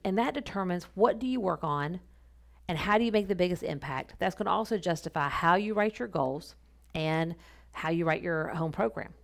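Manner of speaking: ordinary talk, nothing marked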